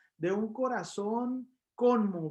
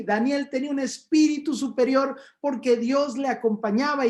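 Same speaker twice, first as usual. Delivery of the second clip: raised, louder preaching push; fervent preaching; 4 semitones higher, 6 dB louder than usual. Acted. Preacher exhorting the faithful, at full force. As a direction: preach it out to the congregation with fire.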